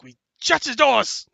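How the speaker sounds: high, slow hobgoblin shout. Acted. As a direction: bellow the decision, drawn out. GM voices hobgoblins deciding.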